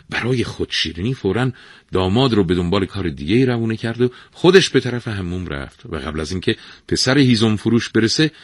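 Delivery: plain reading voice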